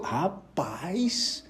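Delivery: breathy hushed rapaz; whispered aside. Real, not acted. Hushed aside to listeners, whispered. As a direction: drop to a whisper for the word. Aside while telling the story.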